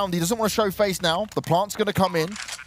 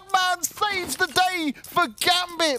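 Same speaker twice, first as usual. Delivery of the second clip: much higher, slow shouted call; excited shout; 12 semitones higher, slower than usual. Real, not acted. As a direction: shout it in disbelief. Caster shouting at ace clutch.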